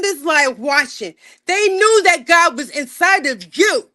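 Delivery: rally the viewers like a preacher; full-force live-stream preaching. Acted.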